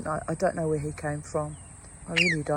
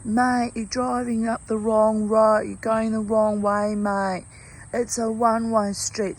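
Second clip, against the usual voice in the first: raised, drawn-out call to a driver; yelling out; 5 semitones higher, 6 dB louder than usual. Retold or imitated. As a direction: imitate homeless men hollering a warning, louder and higher. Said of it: "the homeless men yell out".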